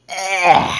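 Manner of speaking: loud, high frustrated "eh"; loud garbled outburst at a flub; tongue-tied groan. Real, not acted. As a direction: blurt a noise after fumbling the words. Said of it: "I cannot talk, dude".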